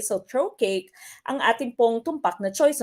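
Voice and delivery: usual speaking voice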